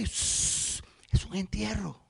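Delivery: breathy whispered shush, voice dropped to nothing; hushed whisper. Acted. Crowd hushing each other at a funeral, whispering. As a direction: hush them like mourners are passing.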